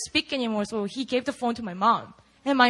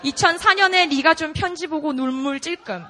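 fast reread; 3 semitones higher, 4 dB louder, much faster than usual. Retold or imitated. Rereading the text in Korean.

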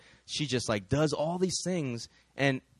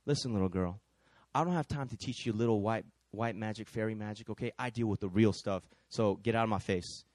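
low, quiet contempt; menacing warlock; 7 semitones lower, 7 dB quieter than usual. Acted. Warlock leaning over the counter at a girl, low menace. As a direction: lean in low and dismiss them. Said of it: "he leans over the counter and says".

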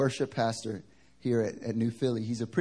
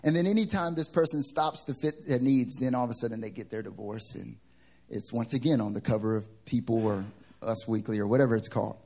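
ordinary talk

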